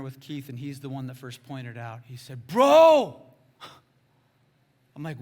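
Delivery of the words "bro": high, very loud burst; shouted exclamation. Retold or imitated. Friend bursting out to the preacher, shouting. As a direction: yell it as a sudden realisation. Friend's realisation, retold at full volume.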